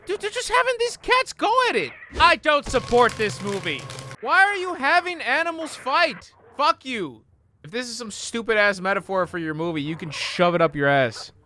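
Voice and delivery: high, loud angry outburst; outraged rant at the screen; indignant rant. Real, not acted. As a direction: yell at the filmmakers for the cat fight. Outraged at the cat fight.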